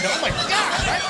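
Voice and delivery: normal talking voice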